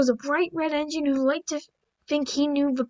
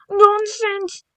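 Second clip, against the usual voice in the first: huffy dismissal; 6 semitones higher, 5 dB louder than usual. Acted. James huffing at driver.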